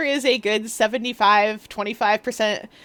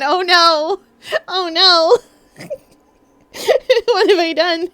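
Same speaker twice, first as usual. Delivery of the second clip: loud, high playful dismay; mock horror; 6 semitones higher, 7 dB louder than usual. Streamer regretting a Coronation Street watch party idea, mock horror. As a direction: wail it in laughing mock alarm.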